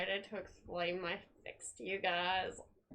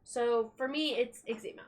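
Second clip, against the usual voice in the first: ordinary talk, nothing marked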